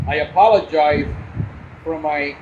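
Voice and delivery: normal talking voice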